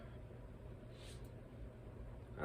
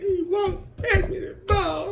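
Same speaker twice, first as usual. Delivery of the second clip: put-on high, slow, weepy child voice; mock the boy's tearful whine; crying kid; 4 dB louder, slower than usual. Crying boy complaining to the coach, high slow weepy kid voice. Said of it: "come back down there crying again".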